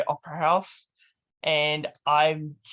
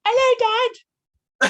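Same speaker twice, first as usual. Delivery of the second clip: put-on very high, loud childlike Cockney squeak; little son Timmy; 7 dB louder than usual. Host voices little Timmy greeting his dad, high Cockney squeak. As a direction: squeak it up like a small London boy. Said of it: "Timmy, his son".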